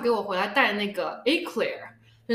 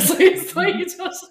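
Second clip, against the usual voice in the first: laughing mid-sentence, higher; 10 semitones higher, 7 dB louder than usual. Real, not acted. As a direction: break into laughter. Laughs at own point.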